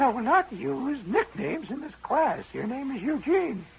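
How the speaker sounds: high, slow, prim schoolmarm refusing nicknames; strict teacher. Retold or imitated. Teacher refusing the boy's nickname, high and prim. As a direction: lay down the rule like a stern teacher.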